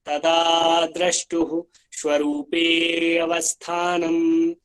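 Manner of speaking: slow chant with a light अ in अवस्थानम्; the alternate way with अवस्थानम्, chanted slowly; alternative reading. Shown as a demonstration. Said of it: "दूसरे तरीके से ऐसे भी बोल सकते हो".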